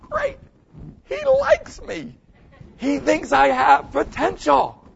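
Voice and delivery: very high, slow, chirpy mock voice of a young player; mock happy girl. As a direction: squeal it like a delighted young girl. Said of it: "you go".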